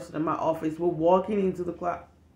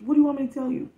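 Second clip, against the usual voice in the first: normal talking voice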